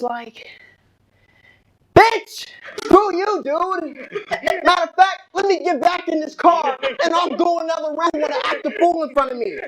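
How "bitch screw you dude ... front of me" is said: indignant comeback. Retold or imitated. Driver firing back at the man he hit, indignant comeback. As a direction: burst out in mock fury. Retorts to insult from victim.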